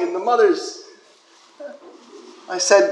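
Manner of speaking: ordinary delivery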